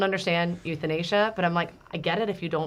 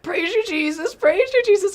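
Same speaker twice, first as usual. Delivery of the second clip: much higher, louder sing-song praise; mocking imitation of mom; 8 dB louder than usual. Kids mimicking mom on a bad day, high mock praise. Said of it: "they imitate me on a bad day".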